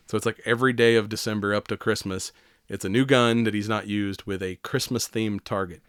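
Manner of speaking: usual speaking voice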